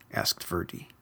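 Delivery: ordinary delivery, nothing marked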